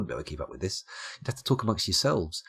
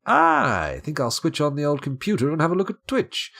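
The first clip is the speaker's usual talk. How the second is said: higher-pitched put-on American accent; mock American accent; 7 semitones higher, 4 dB louder than usual. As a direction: imitate an American with a higher, louder voice. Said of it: "Not in that accent".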